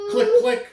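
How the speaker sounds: high, loud playful click sounds; stapler noise. Acted. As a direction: chirp the stapler clicks gleefully. Daisy staples her stories.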